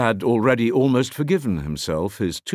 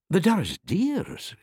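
higher, slow startled question; surprised cry; 10 semitones higher than usual. A narrator's reading. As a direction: catch at the name in surprise. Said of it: "cried I".

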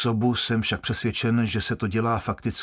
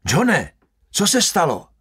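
higher, louder worried call; urgent cry; 6 semitones higher, 4 dB louder than usual. A narrator's reading. Guard crying out to choking John, alarmed.

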